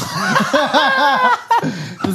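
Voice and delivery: long, high-pitched burst of laughter; real laughter. Real, not acted. Guest admitting the instant Caribbean holiday, bursting out laughing. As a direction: crack up at your own confession. Laughs at his own splurge.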